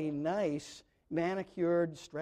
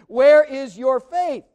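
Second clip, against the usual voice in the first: Where is your faith? much louder and slow, Where hit hard and falling; emphatic rebuke; 5 semitones higher, 15 dB louder, slower than usual. Shown as a demonstration. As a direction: thunder it out to the congregation. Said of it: "Jesus says".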